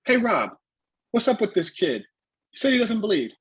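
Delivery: high-pitched mimicked complaint; imitated wrestling veteran. Veteran complains to promoter about the kid, imitated in high voice. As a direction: call out the promoter in a put-on high voice. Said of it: "And then Abdullah's like".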